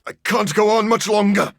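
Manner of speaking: strained, high, pushed voice; forcing the words out, spent; weary strain. Acted. Vampire doctor at the end of his strength, strained. Game character groans in exhaustion.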